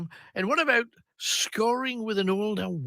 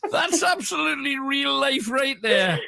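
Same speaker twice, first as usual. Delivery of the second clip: high voice breaking up with laughter; real delighted laughing speech; 6 semitones higher, slower than usual. Real, not acted. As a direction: squeeze the words out through laughter. Host reacts while cracking up.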